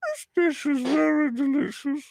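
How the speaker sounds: high, slow warbling cook; sing-song high celebrity chef voice; Julia Child impression. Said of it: "Julia Childs".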